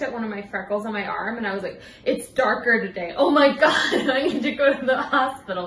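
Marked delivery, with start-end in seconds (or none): 2.0-5.7 louder, higher hammed-up alarm with a laugh; mock panic. Spotting a freckle while teaching class, mock alarm. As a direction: play the hypochondriac, half laughing.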